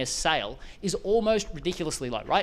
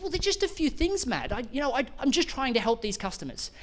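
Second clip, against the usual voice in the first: much higher, modest imitation of a humble client; Wendy, modest; 6 semitones higher than usual. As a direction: play her down, shy about her own work. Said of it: "And she said".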